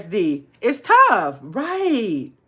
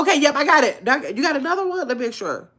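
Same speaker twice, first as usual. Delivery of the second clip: normal talking voice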